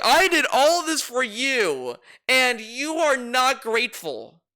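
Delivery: high, slow, indignant imitation; mock self-righteous attitude. Reactor imitating Phil scolding ungrateful viewers, mock self-righteous. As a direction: drag it out as a mock martyr. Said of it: "But look at his attitude".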